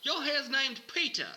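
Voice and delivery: loud, high Aussie host shouting; hammed-up Australian presenter; Australian accent. [name] showing Shannon the rabbit, loud Australian accent. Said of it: "I will try to do mine in an Australian accent".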